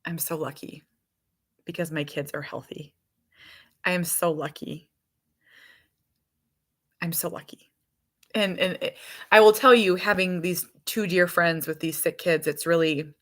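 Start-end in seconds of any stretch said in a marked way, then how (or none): 0.0-7.7 hushed, softer self-reminder; low-key, sincere murmur of gratitude; quiet, heartfelt. Retold or imitated. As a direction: say it softly, with long reflective pauses. Moved, reflecting on her healthy kids.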